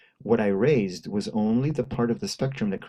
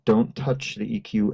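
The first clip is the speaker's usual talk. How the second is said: normal talking voice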